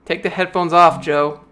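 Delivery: usual speaking voice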